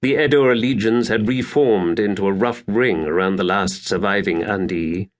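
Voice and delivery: usual speaking voice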